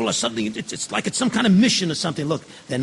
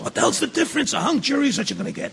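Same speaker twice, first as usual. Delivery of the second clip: higher, fast exasperated retort; exasperated outburst; 8 semitones higher, faster than usual. Acted. Fed-up juror snapping back at a fellow juror, exasperated. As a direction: throw the question back at him, fed up. Heated jury room quarrel.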